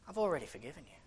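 low, soft word of pardon; quiet reassurance. Retold or imitated. The Lord answering the praying preacher, soft low reassurance. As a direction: let it drop gently and quietly. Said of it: "And he says".

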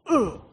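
acted thud-in-the-gut groan; grunt of being kicked. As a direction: grunt as if taking a blow. Acting out blows Joseph takes.

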